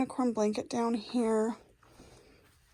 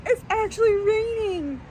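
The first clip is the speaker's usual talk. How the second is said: bright, raised excited voice; 9 semitones higher than usual. Real, not acted. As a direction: share happy news, lit up. Excited about rain arriving.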